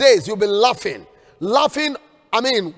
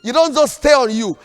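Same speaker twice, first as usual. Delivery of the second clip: ordinary talk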